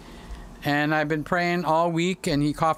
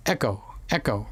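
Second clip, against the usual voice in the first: imitated echo; 7 semitones lower, 3 dB quieter than usual. Shown as a demonstration. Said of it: "I just get echo".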